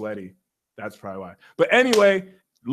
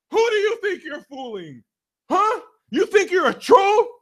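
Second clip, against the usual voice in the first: high mock scolding; 10 semitones higher than usual. Real, not acted. Confronting Pisces.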